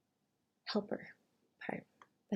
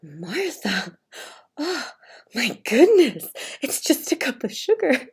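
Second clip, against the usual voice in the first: higher, louder fake-patient scolding; mock exasperated husband; 5 semitones higher, 9 dB louder, slower than usual. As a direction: play the reasonable one for the neighbor. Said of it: "then he's like".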